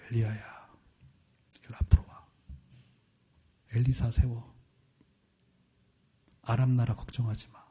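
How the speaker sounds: soft whisper of God; barely breathed, low and hushed; still small voice. Acted. God calls Elijah softly, a hushed whisper. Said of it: "작고 가느다란 목소리를 뜻해요".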